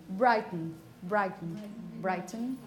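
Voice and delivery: "Brighton" said carefully as a model, stressed first syllable, reduced second; "Brighton" with strong first syllable and swallowed ending; model pronunciation. Shown as a demonstration. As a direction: demonstrate "Brighton" word by word, with pauses between. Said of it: "It's pronounced exactly the same".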